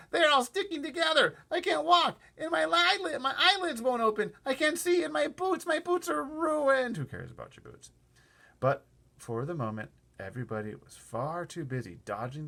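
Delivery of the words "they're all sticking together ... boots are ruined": high, loud whining complaint; angry wailing. Acted. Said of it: "screamed with anger".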